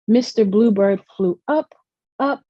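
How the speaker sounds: usual speaking voice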